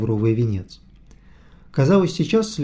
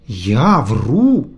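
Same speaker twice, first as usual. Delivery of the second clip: offended; 5 dB louder than usual. A narrator's reading. Vityukha mock offended.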